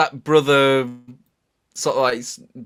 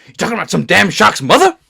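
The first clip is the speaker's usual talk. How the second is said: quoting a movie character; 6 semitones higher, 5 dB louder, faster than usual. Retold or imitated. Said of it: "he just comes up with".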